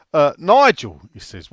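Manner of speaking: normal talking voice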